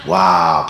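whispered awed gasp; breathless crowd wow. Retold or imitated. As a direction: gasp it like an amazed audience. Crowd gasping at whale.

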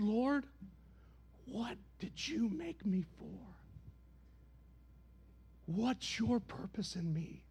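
quiet, slow humble plea; soft prayer. Acted. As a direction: pray it softly and slowly.

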